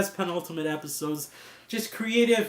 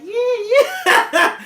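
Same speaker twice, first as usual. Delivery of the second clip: imitating a celebratory cheer, much higher and louder; playful imitated yeah yeah; mock celebrating; 6 dB louder than usual. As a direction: cheer like you're dancing at a party. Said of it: "dancing, like".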